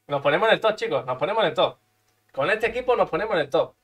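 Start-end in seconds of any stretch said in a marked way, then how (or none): none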